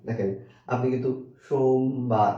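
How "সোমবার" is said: সোমবার drawn out in two beats; slowly divide সোমবার as সোম-বার; syllable by syllable. Shown as a demonstration. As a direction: stretch সোম, then land on বার. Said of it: "নাকি দুইবার উচ্চারণ করছেন".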